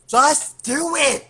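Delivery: high, drawn-out shout; exasperated order. Acted. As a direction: yell it slowly at a reluctant comrade. Acted order to reluctant Sam.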